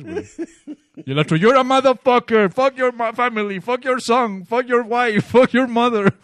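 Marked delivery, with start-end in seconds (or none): 1.4-6.1 high, loud string of mock insults; mock angry tirade. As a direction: imitate a furious caller cursing on and on. Imita la retahíla de insultos. (imitando una retahíla de insultos a gritos)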